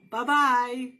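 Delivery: usual speaking voice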